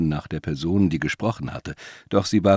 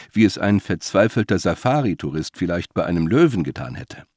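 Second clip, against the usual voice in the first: ordinary delivery, nothing marked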